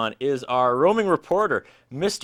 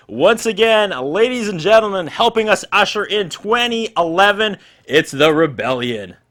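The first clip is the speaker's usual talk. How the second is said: loud, higher band introduction; announcer intro; 7 semitones higher, 7 dB louder than usual. Real, not acted. A host introducing the band to the crowd, loud and announcer-like.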